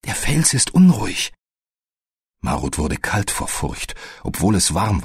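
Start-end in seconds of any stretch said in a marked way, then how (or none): none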